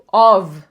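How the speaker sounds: OF with a full, strong vowel and clear v; citation-form "of", every sound pronounced. Shown as a demonstration. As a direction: say "of" loudly, unreduced. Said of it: "vai virar a".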